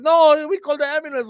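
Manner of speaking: high, loud mock alarm; panicked neighbour. Neighbour telling fallen Mike about help, high loud panic. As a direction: cry it out as the frantic neighbour. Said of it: "He's like".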